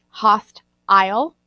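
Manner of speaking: "host, isle" as two separate pieces, HAHST, ILE; broken into parts. Shown as a demonstration. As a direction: split root from ending, "host" then "isle". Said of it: "think of the root and then the ending".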